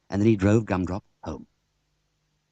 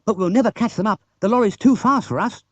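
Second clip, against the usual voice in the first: excited; 13 semitones higher, 5 dB louder than usual. Acted. Bill excited chase.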